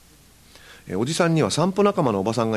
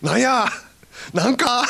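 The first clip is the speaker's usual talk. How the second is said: much higher, laughing Hakata challenge; Hakata challenge replay; 13 semitones higher than usual. Retold or imitated. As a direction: relish the line in Hakata dialect. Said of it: "repeating the Hakata challenge". (博多弁の啖呵を楽しげに)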